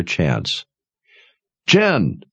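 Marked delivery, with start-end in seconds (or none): 1.7-2.2 high call, name thrown across. A narrator's reading. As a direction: call her name sharply. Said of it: "Sebastian called".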